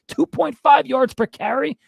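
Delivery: high, slow disbelieving question; outraged disbelief, voice shooting up high; incredulous repeat. Real, not acted. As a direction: say it like you can't believe the number.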